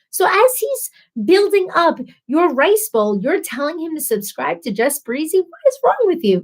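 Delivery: high-pitched, incredulous telling-off; mock scolding. Wife telling off husband for plugging her channel, mock scolding.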